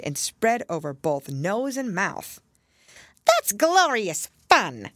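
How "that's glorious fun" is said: slow, high and loud mischievous glee; a wicked sprite relishing the fun; gleeful sprite voice. A narrator's reading. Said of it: "said the sprite".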